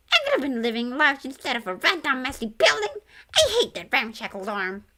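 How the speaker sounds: loud, laughing creature voice; Grim complaining. A narrator's reading. Grim complaining to the ghosts, loud and laughing. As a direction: rant with a laugh in it.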